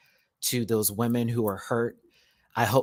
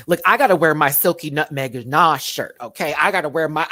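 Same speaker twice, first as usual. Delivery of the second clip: hyped declaration; 4 semitones higher, 7 dB louder than usual. Real, not acted. Hyped about wearing the shirt.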